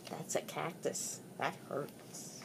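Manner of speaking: whispered aside. Acted. Aside after shouting.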